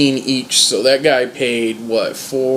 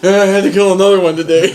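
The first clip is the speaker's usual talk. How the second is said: higher, laughing breezy quote; laughing mock vet; 5 semitones higher, 4 dB louder than usual. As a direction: giggle it out as a careless vet. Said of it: "she'll be like".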